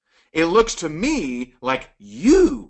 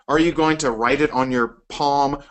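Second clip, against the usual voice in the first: ordinary talk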